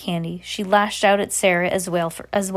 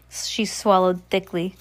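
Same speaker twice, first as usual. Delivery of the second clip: ordinary delivery, nothing marked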